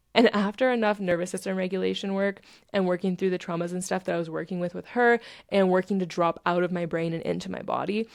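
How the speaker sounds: usual speaking voice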